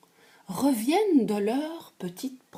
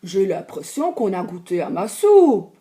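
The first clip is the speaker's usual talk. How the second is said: big bear voice; 3 semitones lower, 6 dB louder than usual. Acted. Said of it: "le grand ours ... dit".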